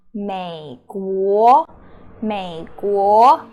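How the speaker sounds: 美国 said very slowly; slow demo. Shown as a demonstration. Teacher slowly modelling 美国 for the class. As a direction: stretch out 美国 for learners.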